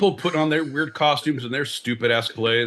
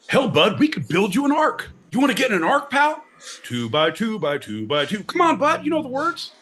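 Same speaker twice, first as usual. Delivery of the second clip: hammed-up pushy church salesman; mock eager pitch; 7 semitones higher than usual. Pushy church guy recruiting a theater kid, hammed-up high pitch.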